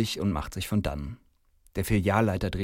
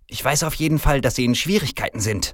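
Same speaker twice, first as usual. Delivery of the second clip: raised, irate manager; hissed anger; 7 semitones higher, 5 dB louder than usual. A narrator's reading. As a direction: snap back with a threat. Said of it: "faucht der Mann".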